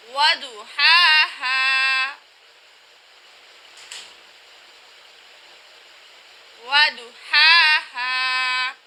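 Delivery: slow وَدُحَاهَا, first HA pharyngeal, second glottal; two distinct ha sounds. Shown as a demonstration. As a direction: draw out both ha's in وَدُحَاهَا, tight then open. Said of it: "ہم نے ہا اور ہا میں واضح فرق کیا". (وَدُحَاهَا میں دونوں ہا کھینچ کر الگ الگ)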